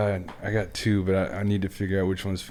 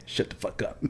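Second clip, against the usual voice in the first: hushed imitated order; 8 dB quieter than usual. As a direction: whisper it like a drill instructor shutting someone up. Instructor silences recruit, retold.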